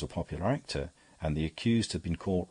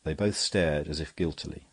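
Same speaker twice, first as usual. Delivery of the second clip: ordinary delivery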